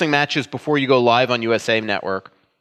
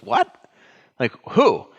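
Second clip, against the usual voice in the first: incredulous; slower than usual. Retold or imitated. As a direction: act out a friend's baffled double take. Retelling Dan's astonished reaction.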